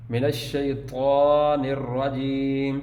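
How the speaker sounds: من الشیطان الرجیم recited slowly, with a doubled ش and a heavy ط in الشیطان; clear doubled sheen. Shown as a demonstration. Teacher recites for pupils, stressing the ش in الشیطان. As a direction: lean into the ش of الشیطان. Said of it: "یہ شین کی آواز آنی چاہیے".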